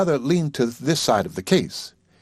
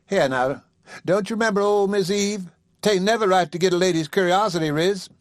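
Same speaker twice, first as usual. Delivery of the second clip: higher woman's voice, mock scolding; a coaxing lady, pitched up; coaxing Sally; 7 semitones higher than usual. Sally pleading playfully.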